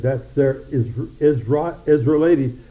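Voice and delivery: stumbling over "Israeliti-", breaking off and restarting; tongue-tied. Real, not acted. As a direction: trip over the long word. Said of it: "I'm having trouble with my speech".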